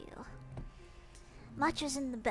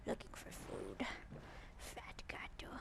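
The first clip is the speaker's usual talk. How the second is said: slow soft whisper; 3 semitones lower, 5 dB quieter, slower than usual. Real, not acted. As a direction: breathe it out, barely voiced. Whispering over the snooping cat.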